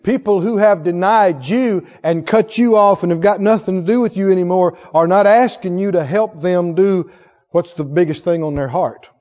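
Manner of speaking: ordinary delivery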